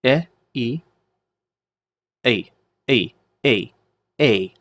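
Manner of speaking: "E, E" as eh and ee, merged into "A"; teacher's demo of eh gliding into ee; blended diphthong. Shown as a demonstration. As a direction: sound out both vowels, then repeat "A" as ay. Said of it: "It's pronounced like the eta".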